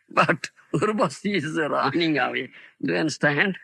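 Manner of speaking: usual speaking voice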